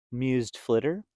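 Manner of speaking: ordinary delivery, nothing marked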